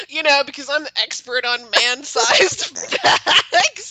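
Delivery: slow, laughing through the words; real laughter breaking each word. Real, not acted. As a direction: giggle your way through the joke.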